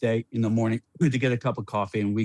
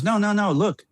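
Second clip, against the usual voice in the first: put-on insistent kid; 8 semitones higher than usual. Excited kid insists to mother.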